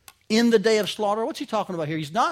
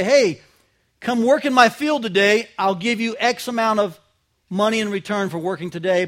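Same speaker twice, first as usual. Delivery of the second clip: louder, hearty call to hired hands; pagan landowner calling; 2 semitones higher, 7 dB louder than usual. Retold or imitated. Landowner hiring day laborers in his field, a hearty mild call. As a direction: beckon the day laborers over, a touch louder. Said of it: "and they would say".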